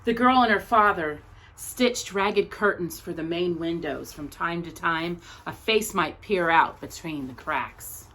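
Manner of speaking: measured, expressive picture-book reading; story narration. Acted. Teacher reading a picture book about Anne Frank's tree to her class, gentle storytelling. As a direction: read it like a storyteller at circle time.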